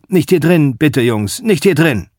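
put-on old barkeeper shouting to be heard; calling out. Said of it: "ruft immer zu".

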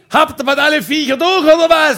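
loud, high neighbour's yell; mock angry shout. Neighbour to the noisy couple, mock angry shout. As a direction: yell it, building to the last word. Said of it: "Der Nachbar hat immer geschrien".

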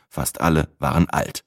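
plain reading voice

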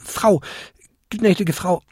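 high, flustered goblin voice; meek, high-pitched and unsure; stammering apology. A narrator's reading. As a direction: grope haltingly for the right form of address. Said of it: "stammelte der kleinste Winterkobold".

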